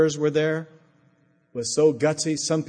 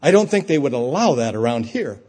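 indignant; 7 dB louder than usual. Retold or imitated. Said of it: "gets this really indignant look on her face and says".

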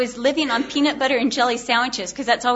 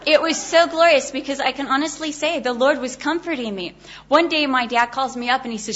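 ordinary delivery

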